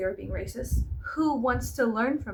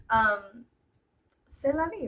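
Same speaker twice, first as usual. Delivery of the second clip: ordinary talk, nothing marked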